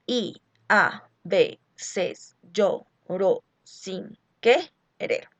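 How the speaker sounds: very slow, every syllable pulled apart; drawn-out metrical reading of the verse; scanning syllables. Shown as a demonstration. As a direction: count out the metre syllable by syllable. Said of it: "contemos número de sílabas".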